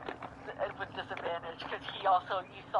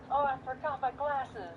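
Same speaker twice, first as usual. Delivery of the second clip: put-on older man; 8 semitones higher, slower than usual. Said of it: "you hear a voice going".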